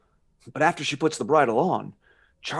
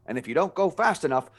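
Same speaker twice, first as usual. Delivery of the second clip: normal talking voice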